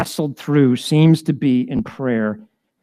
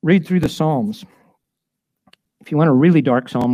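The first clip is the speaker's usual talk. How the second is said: normal talking voice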